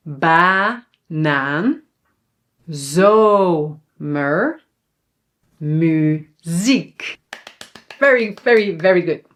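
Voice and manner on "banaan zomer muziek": BANAAN, ZOMER, MUZIEK split into drawn-out syllables; long open vowels. Shown as a demonstration. Teacher has learners repeat after her, long drawn-out vowels. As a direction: model each word slowly, first vowel held long. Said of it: "repeat again after me".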